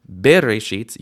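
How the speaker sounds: BERESHIT stressed hard on the opening BE; wrong first-syllable stress. Shown as a demonstration. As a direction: punch the BE of bereshit. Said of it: "never have a stress on a shva".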